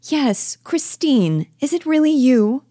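high, loud burst of surprise; suddenly recognise a long-lost friend; astonished cry, pitch leaping right up; sudden recognition. Acted.

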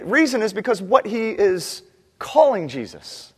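ordinary delivery

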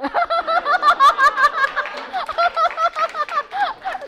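long, loud, high laugh that falls away; a big real belly laugh; long hearty laugh. Real, not acted. Doctor laughing about being trained to kill, long hearty laugh.

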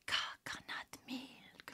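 put-on whispered slow Eastern European accent on "Coconut milk"; mock accented whisper. Vlogger imitating the TikTok girl's accented whisper. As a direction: breathe "Coconut milk" slowly in her accent. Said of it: "she'll say coconut milk, but she says coconut milk 'cause of her accent".

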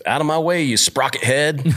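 high, hammed-up grumpy old jeeper; gruff trail guy. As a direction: bark it out like a cranky stranger passing by. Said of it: "this guy just goes".

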